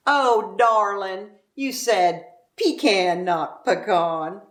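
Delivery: slow, syrupy mock sympathy; slow patronizing Southern belle; condescending pity. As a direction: talk down sweetly and slowly. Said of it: "followed by the reason that you're a poor darling".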